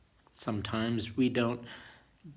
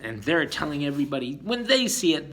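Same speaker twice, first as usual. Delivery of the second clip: usual speaking voice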